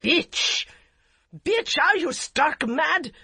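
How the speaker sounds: loud, slow furious roar; enraged. A narrator's reading. Husband enraged at his wife, loud and slow. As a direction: bellow at her in rage. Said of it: "The voice was so enraged".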